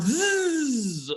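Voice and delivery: high buzzing zap sound; zapping buzz noise; electric buzz. Acted. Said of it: "I like making that sound".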